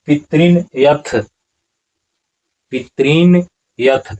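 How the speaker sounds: "पितॄन यथ" split into two words, said slowly; slowly "पितॄन" then "यथ", clearly apart; careful recitation. Shown as a demonstration. Teacher recites "पितॄन यथ" slowly for students. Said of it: "यहाँ पर क्या होगा".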